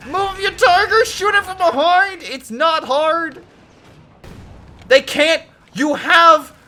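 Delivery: high, loud exasperated shouting at the screen; frustrated yelling. Real, not acted. Caster yelling at the player to move his Tiger, loud and exasperated. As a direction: yell at the player to just move it.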